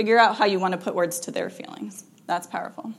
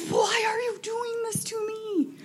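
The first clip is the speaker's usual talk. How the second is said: high, drawn-out wail, hammed up; mock desperate wail; slower than usual. New parent to five-day-old baby, mock desperate wail.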